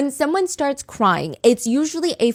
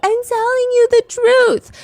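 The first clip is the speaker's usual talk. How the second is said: high, trembling fake sob; put-on tearful plea; shaky voice; 13 semitones higher, 3 dB louder than usual. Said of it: "scrunched up and emotional and crying and shaking".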